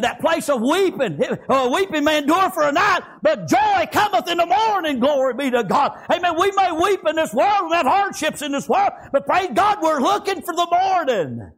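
impassioned preaching. Real, not acted. Preacher promising congregation joy in the morning, impassioned. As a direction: build toward joy with a preacher's cadence.

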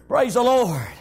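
normal talking voice

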